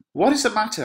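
high, loud theatrical question; alarmed demand. Acted. Lady Capulet arriving at the noise, alarmed demand.

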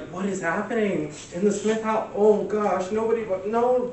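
high-pitched, appalled reaction; mock dismay. Speaker acts out public reacting to celebrity oversharing, mock dismay. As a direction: exclaim in high mock horror.

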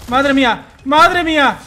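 loud, high cries of amazement; excited shout. Real, not acted. Gamer celebrating a clutch win, loud high shout. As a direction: shout it out in delight.

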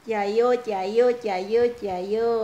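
loud rhythmic cheer; rallying cheer. Real, not acted. Vlogger cheering on those working for family, loud chant. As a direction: chant it out like encouragement.

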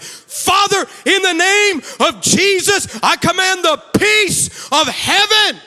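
high, very loud commanding prayer; shouted prayer. Retold or imitated. Daughter praying on live TV, imitated full shout. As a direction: belt it out like a fierce little preacher. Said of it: "all right, shouting it".

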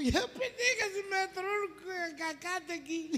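high, tearful comic complaint; squeaky, strained husband; distressed whining. Acted. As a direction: wail it slowly as if in trouble. Husband whines about stuck peanut.

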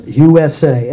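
low, hard and forceful; menacing low delivery; dangerous-sounding. Shown as a demonstration. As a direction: spell it out like a threat. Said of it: "It sounds more dangerous".

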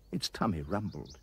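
usual speaking voice

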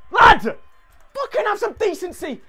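high, drawn-out complaint; indignant high-pitched appeal; exasperated protest. Real, not acted. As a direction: plead loudly, then slow and high. Protesting to opponent.

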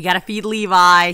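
loud insistence on feeding Levi; emphatic. Real, not acted. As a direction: push it firmly. Restating the priority.

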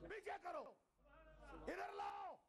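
high shouted orders; commanding shout. Retold or imitated. Prophet ordering the angels, shouting. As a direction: snap out the orders with full authority. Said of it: "یہ لہجہ میں کس لیے بتا رہا ہوں".

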